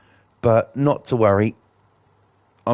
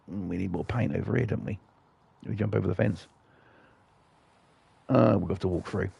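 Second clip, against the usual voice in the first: muttered under his breath, much softer; muttered aside; 2 semitones lower, 10 dB quieter than usual. Real, not acted. Gamer mumbling to himself mid-task, much softer muttering. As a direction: mumble it to yourself, barely audible.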